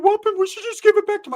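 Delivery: put-on very high squeaky kid voice; high loud little-kid plea; kid Ricky. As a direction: squeak it out like an eager little boy. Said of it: "Ricky's like".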